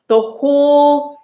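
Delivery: WHOLE stretched out high and loud; incredulous emphasis. Real, not acted. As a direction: hold "whole" long and loud for impact. Amazed that entire clear coat peeled.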